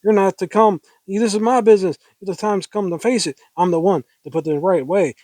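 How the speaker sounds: high, urgent hysterical pleading; hammed-up panicky heroine; hysterical woman. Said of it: "I like being kinda hysterical".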